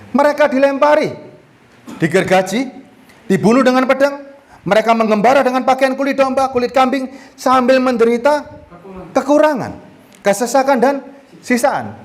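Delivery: preacher reading martyrdom verses with fervor; emphatic scripture reading. Acted. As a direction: proclaim the verses to the congregation. Reads Hebrews passage aloud.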